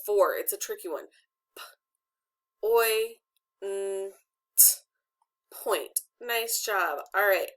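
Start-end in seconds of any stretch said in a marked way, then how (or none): 1.6-4.6 each sound of P, oi, n, t alone with clear pauses. Shown as a demonstration. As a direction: sound out P, oi, n, t separately. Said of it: "How many sounds are in the word point".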